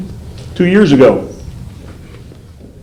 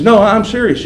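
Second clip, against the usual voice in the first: caller insisting; 8 semitones higher than usual. Retold or imitated. Caller voiced in the retold call.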